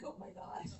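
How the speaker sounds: whispered, laughing "oh my God"; breathless laugh. Real, not acted. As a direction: giggle it out under your breath. Awed at meeting the president.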